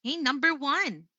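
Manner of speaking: usual speaking voice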